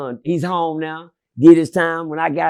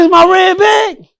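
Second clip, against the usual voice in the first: slow, high, loud claim; retold defiant shout; 13 semitones higher, 11 dB louder, slower than usual. Telling the boss the bag is his, with a slow shout. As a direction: shout it like it's yours to keep.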